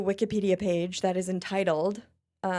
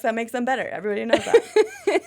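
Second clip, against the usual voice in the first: higher, louder mock sarcasm through a laugh; sarcastic; 4 semitones higher, 7 dB louder than usual. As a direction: lay on the fake sarcasm. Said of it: "See, I can be sarcastic".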